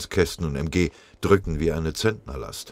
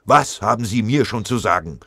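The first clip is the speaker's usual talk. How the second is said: loud, higher, angry outburst; bellowing; 6 semitones higher, 5 dB louder than usual. A narrator's reading. Corporal losing control at the sergeant, angry bellow. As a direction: bellow back at your superior. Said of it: "dieser fing zu brüllen an".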